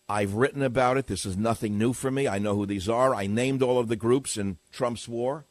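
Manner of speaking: usual speaking voice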